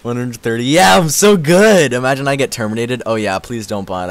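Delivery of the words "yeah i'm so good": loud, high, drawn-out brag; gleeful boast. Pretend. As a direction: crow it slowly to the chat. Boasting at viewer count.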